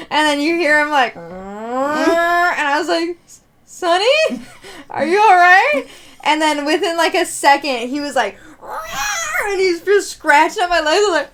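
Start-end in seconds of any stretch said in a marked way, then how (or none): 3.3-5.8 worried calling out. Retold or imitated. Calling to the cat.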